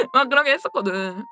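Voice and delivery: ordinary talk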